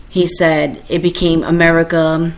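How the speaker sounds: usual speaking voice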